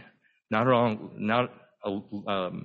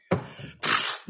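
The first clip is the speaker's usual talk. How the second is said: overwhelmed sigh; 4 dB quieter than usual. Retold or imitated. Lost in genealogies, deer in headlights.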